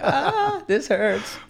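high, whiny mock complaint with a laugh; whiny wimp. Mocking himself to a friend as a whiny wimp, high and whining. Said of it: "I'm already a big pussy".

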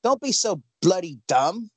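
hammed-up British, like Sherlock; British accent. Podcaster scolding doubters for laughs, mock British accent. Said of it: "it sounds like Sherlock".

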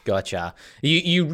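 ordinary delivery

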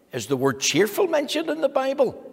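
high, bright re-ask of the question; lifted question. Real, not acted.

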